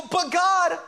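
exaggerated high-pitched protest; protesting whine. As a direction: push back at God, pleading. People protesting back to God.

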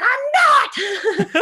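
high, loud yell with a laugh; retold yell. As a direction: shout the refusal back, half laughing. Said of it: "I yelled at you".

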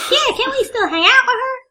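very high southern-tinged cartoon squeak; cartoon character voice. Vampire asking to hang out, squeaky cartoon voice. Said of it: "sound like a South Park character".

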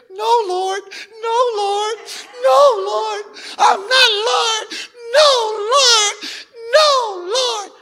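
put-on very high, loud baby-like bawling; hammed-up sobbing refusal; mock crying. Preacher imitating someone weeping while refusing the Lord, mock crying. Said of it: "cry like a baby".

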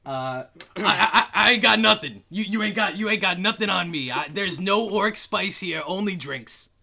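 loud, high, stammering protest; flustered denial. Acted. Larry denies Orc Spice to the police, loud flustered denial. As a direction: deny it hotly, tripping over the words.

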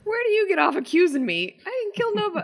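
very high, loud outraged squawk; hammed-up indignation, voice shooting way up; indignant protest. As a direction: protest the accusation in a shrieky, exaggerated pitch. Acted indignant reply to accusation.

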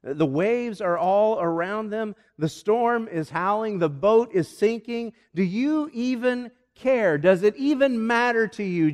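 acted panic, pitch climbing; alarmed pleading. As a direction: plead harder with every phrase. Disciples' rising panic in the storm.

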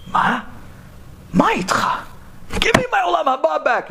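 high, exasperated cabbie; insist, pitch climbing; demanding. Acted. Taxi driver demanding the kollel guy return it, high exasperated voice.

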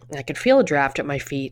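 usual speaking voice

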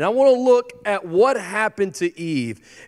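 ordinary talk

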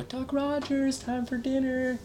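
high, slow mom voice calling out; mom calling for dinner. Retold or imitated. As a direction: call him in from the window like a mother. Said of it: "That was my mom impression".

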